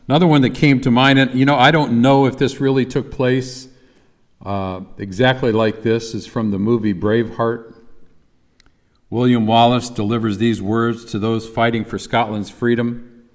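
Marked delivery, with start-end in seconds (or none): none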